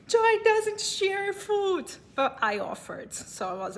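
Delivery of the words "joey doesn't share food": high, slow, mock-stern Joey line; mock-guard the fries like Joey; playing Joey from Friends. To the handsome guy taking her fries, she quotes Joey in a mock-stern voice. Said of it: "I was almost a Joy".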